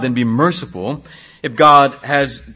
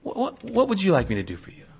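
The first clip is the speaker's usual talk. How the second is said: soft, higher, overly sorry tone; mock sympathy; 5 semitones higher, 8 dB quieter than usual. Imagined God soothing complaining Israelites, mock-sympathetic and soft. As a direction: mockingly play the soothing helper. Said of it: "Does God say to them".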